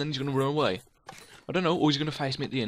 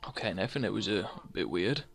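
soft, lower mock-wary voice; uneasy reply; 6 semitones lower, 7 dB quieter than usual. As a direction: answer quietly, as if unnerved. Uneasy reply to creepy Nathan.

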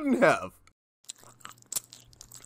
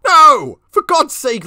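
2 loud, sharp protest; 3 shout it down in outrage; 4 emphatic loud no; 1 indignant shout; 6 semitones higher, 7 dB louder, slower than usual. Acted. Brother insists, denies it's a game.

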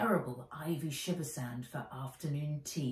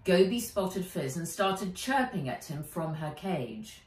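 usual speaking voice

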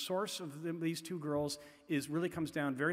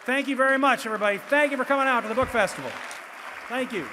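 projected, louder thank-you over applause; 8 semitones higher, 12 dB louder, faster than usual. Real, not acted. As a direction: raise your voice over the crowd.

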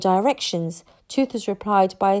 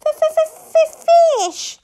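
very high, loud stuttering squeal; little dragon; 8 dB louder than usual. A narrator's reading. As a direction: stammer the demand in a piercing creature voice. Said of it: "said Toothless again".

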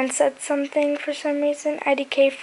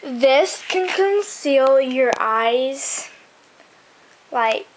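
very slow, drawn-out fake commercial pitch; mock advert; much slower than usual. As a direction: stretch it like a spoof product promo. Mock advert, then just kidding.

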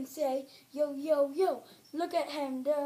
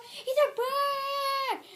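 shouted taunt; 7 semitones higher, much slower than usual. Pretend. Repeated taunt building to shout.

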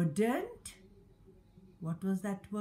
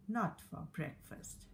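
ordinary delivery, nothing marked

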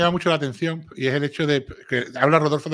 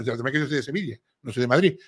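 usual speaking voice

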